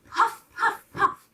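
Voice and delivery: high, loud puff puff; puffing train sound. A narrator's reading. Narrator making the engine puff.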